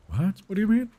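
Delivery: usual speaking voice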